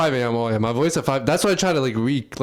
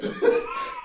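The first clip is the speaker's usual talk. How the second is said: breathless high-pitched giggle; helpless giggling fit; real laughter squeaking up high; 4 dB quieter than usual. Real, not acted.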